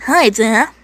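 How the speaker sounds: lightly acted Texan accent on "Hi, Da"; corrected Texan accent. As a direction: greet Dad in a put-on Texan voice. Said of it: "Mate, wrong accent".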